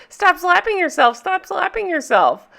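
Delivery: high-pitched playground teasing; imitated singsong taunt; mock taunting. Retold friend taunting while slapping her.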